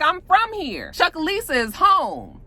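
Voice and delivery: high-pitched emphatic retort; indignant insistence. Retold or imitated. Andre arguing with wife over home, indignant. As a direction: stake the claim, pitched high.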